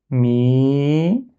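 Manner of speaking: mi with a long drawn-out i, rising at the end; prolonged vowel. Shown as a demonstration. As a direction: sustain the i in "mi", then lift it. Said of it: "il sert à prolonger".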